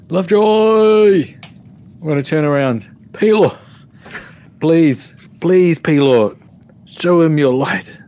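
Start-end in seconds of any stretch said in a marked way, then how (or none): 0.1-1.3 drawn-out high cry of a friend's name; anguished cry. Acted. Cleric crying out to fallen Lovejoy, loud and drawn out. 3.1-8.0 loud, high pleading to a god; fervent prayer. Acted. Cleric begging Pelor to save Lovejoy, loud high pleading. Cleric prays aloud for healing.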